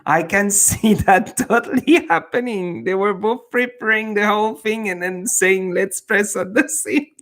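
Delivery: high-pitched, laughing as you talk; amused. Real, not acted. Streamer teasing friends who planned arriving together, laughing. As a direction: crack up picturing their little plot.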